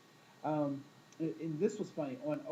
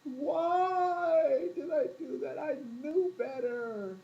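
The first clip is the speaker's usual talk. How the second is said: high, slow, loud wail of regret; kicking yourself after a bad choice, slow and high; self-reproach; 11 semitones higher, 5 dB louder, much slower than usual. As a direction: drag it out, scolding yourself. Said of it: "oftentimes I'm like".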